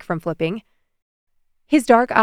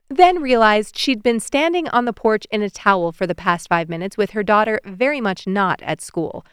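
usual speaking voice

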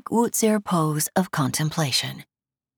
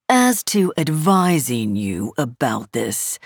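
lazy arrogant nobleman; 3 semitones lower than usual. A narrator's reading. Cold instructor insult.

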